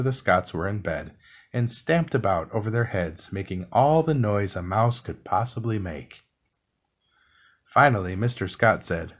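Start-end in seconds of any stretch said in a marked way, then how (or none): none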